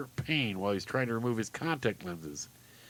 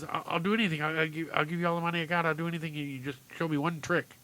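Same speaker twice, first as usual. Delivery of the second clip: put-on higher, rushed, stammering kid; pleading little boy; 5 semitones higher, faster than usual. Boy begs clown to show one trick, rushed and pleading. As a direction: tumble over the words in a timid plea.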